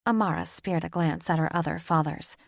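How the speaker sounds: plain reading voice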